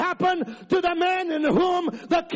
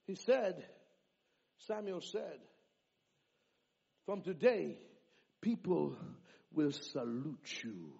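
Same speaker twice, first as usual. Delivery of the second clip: hushed retelling; 9 semitones lower, 14 dB quieter than usual. Real, not acted. Quiet dramatic retelling.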